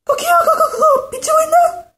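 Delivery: very high, loud crowing shout; rooster crowing. Acted. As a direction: screech like a rooster at dawn. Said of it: "닭이 시끄럽게 울었어요".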